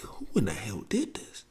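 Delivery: soft unvoiced whisper of rage; a seething uncle's whisper; quiet menace. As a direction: hiss the question, barely voiced. Uncle confronting ruined pictures.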